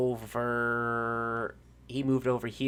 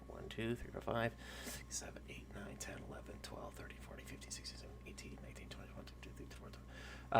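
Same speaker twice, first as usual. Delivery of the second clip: whispered count fading away; whispered head count; counting under breath; 3 semitones lower, 19 dB quieter, faster than usual. Real, not acted.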